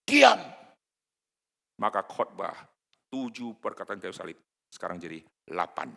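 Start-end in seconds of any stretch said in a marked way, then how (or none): 0.1-0.4 a sudden, high, loud "Diam!"; shouted silence. Pretend. Preacher imagines silencing the thieves, sharp loud shout.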